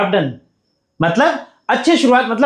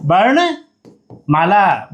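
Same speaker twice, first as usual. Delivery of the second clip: वर्णमाला stretched out slowly for students; slow repeat; much slower than usual. Shown as a demonstration. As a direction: say वर्णमाला syllable by syllable. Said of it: "क्या बोलते हैं?".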